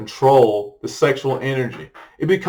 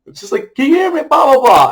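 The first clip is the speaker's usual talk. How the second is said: calling out; 11 semitones higher, 4 dB louder than usual. Retold or imitated. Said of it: "She's like".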